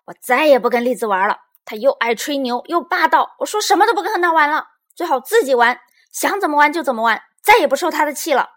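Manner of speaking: high, loud huffy rant; loud, angry venting. A narrator's reading. Little Bear complains to mother about Lizi, loud and angry. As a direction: rant it out in a huff. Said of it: "小熊妹妹大声说".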